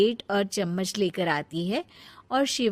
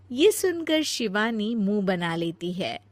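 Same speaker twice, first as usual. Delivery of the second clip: usual speaking voice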